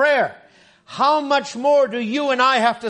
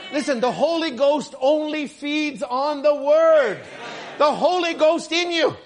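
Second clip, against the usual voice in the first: slow, higher, ringing declaration; fervent preaching; 6 semitones higher, 4 dB louder, slower than usual. Acted. Preacher tells the congregation about the Holy Ghost, fervent and slow. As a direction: lift it up and ring it out slowly. Preacher rising to emphatic point.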